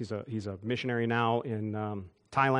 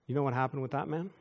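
ordinary talk

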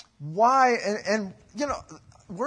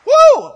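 a wild high whoop, very loud; shrill whoop; 18 dB louder than usual. Retold or imitated. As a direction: yelp of alarm at being caught. Mother finally reaches his name.

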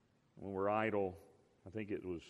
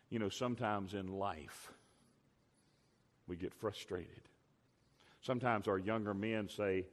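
ordinary delivery

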